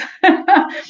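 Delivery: bright burst of laughter; laughing. Real, not acted. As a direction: laugh out loud at the punchline. Laughing at the joke.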